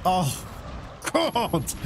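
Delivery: real frustration, pitch up without shouting; frustrated. Real, not acted. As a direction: let out exasperation at the game. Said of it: "Oh, the frustration".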